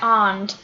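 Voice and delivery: "and" with a back "ah" vowel; Cockney "and". Shown as a demonstration. Showing how Cockneys pronounce it, back-vowelled "and". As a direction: say "and" like "ahnd". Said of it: "Cockneys pronounce it like and".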